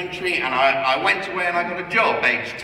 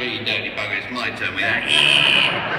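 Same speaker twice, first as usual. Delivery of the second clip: put-on old git voice; 9 semitones lower than usual. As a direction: play a lightly voiced dirty old git. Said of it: "as the old gits".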